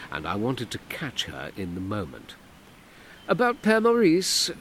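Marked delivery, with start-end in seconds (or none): 3.3-4.5 higher, louder knowing jab; dry woman's voice, one step ahead; wryly guessing woman. Acted. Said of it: "she asked wryly".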